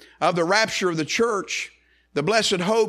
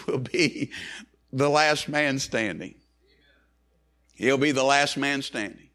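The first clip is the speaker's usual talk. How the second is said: ordinary talk, nothing marked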